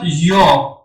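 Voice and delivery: slow, clear یا sounded as "yo"; syllable pronunciation demo. Shown as a demonstration. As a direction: say یا with a rounded vowel, "yo".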